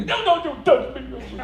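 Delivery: high, slow mock wail; hammed-up tearful wife; imitating sobbing wife. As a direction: whine it like a weeping bride.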